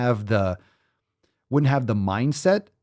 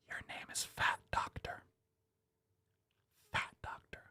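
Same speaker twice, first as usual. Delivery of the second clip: hushed, breathless whisper; 18 dB quieter than usual. Acted. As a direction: whisper it like a secret. Repeating name in hushed awe.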